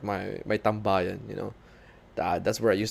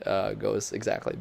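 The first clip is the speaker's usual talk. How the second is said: ordinary talk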